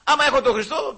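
loud, high, impassioned sermon cry; fervent preaching. Real, not acted. Preacher crying out to the congregation, loud and fervent. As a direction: proclaim it with full conviction.

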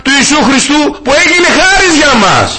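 full-force sermon delivery; preaching. Acted. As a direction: preach it out to the congregation. Preaching to the congregation.

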